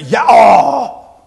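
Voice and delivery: loud, drawn-out waking groan; hammed-up yell at the alarm. Preacher acting out waking to the alarm, groaning yell. Acts reaction to alarm clock.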